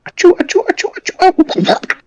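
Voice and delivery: mock sneezing. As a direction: run of squeaky, very high achoos. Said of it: "do you be sneezing".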